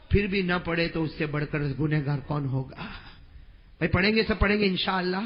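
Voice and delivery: usual speaking voice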